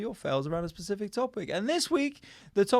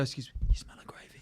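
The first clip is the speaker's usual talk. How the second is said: breathy whisper right at a stranger's ear; 4 semitones lower, 6 dB quieter than usual. Retold or imitated. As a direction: hiss it secretly to a passenger. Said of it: "and just go".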